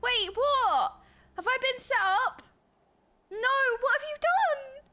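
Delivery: high, rising oh-no outcry; alarmed disbelief. Real, not acted. Streamer realising chat has tricked her, alarmed.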